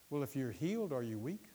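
usual speaking voice

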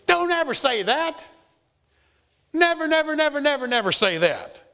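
high, loud, forceful command; emphatic forbidding. Acted. Preacher voicing James to believers, forceful high command. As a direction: James hammering the point home, never budging. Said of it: "James is saying".

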